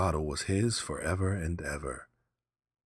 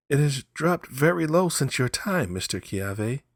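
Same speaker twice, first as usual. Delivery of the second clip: put-on higher, louder young man's voice; 9 semitones higher, 4 dB louder than usual. Young man telling Kiave the price fell, high and loud. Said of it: "said the young man".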